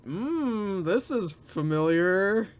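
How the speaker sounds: slow, drawn-out imitation of realizing; mock dawning recognition. Commentator mimicking Chris as he figures it out, mock pondering. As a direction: lightly mimic the cogs slowly turning. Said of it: "like he's".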